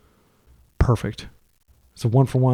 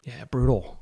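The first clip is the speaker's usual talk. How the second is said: ordinary talk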